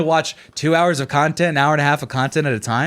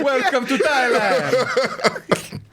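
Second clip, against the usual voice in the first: put-on Thai accent; 6 semitones higher, much slower than usual. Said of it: "that's not a Thai accent either".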